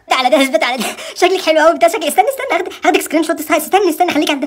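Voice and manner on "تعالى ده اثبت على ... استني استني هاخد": normal talking voice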